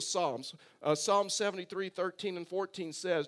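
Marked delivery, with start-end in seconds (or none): none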